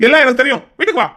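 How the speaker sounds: loud, high, cutting her off; angry command. Acted. Brother ordering his sister home, angry command.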